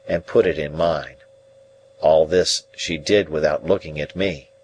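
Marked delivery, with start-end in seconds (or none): none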